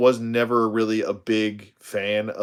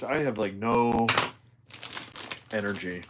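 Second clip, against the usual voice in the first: ordinary talk, nothing marked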